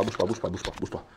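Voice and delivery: hushed, breathless whisper. Real, not acted. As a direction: whisper it, don't move. Hushing someone, says don't move.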